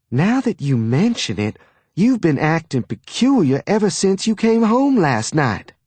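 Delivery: high, prying Southern mother; suspicious mother. A narrator's reading. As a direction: narrow in on him suspiciously. Mother questioning her son.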